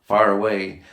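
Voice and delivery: ordinary delivery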